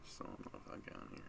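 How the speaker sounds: breathy whispered aside; whispering. Real, not acted. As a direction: drop to a whisper. Unvoiced aside.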